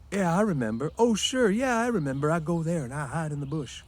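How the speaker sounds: put-on high, eager childlike man; proud simple man remembering; Lenny voice. A narrator's reading.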